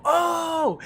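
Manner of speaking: high-pitched sudden "oh!" of an excited yell; imitated shout. Fan mimicking a shout heard from upstairs, high imitated yell. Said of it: "I hear her go".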